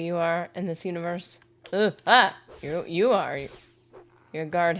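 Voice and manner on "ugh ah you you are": usual speaking voice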